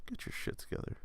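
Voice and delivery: very soft, whispered telling-off; hushed scolding. Acted. Scolds France under the breath.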